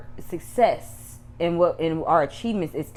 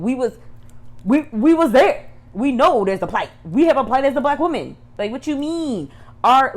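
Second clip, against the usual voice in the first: much higher and louder, insistent; impassioned outburst; 8 semitones higher, 6 dB louder than usual. Real, not acted. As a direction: drive it home with rising indignation.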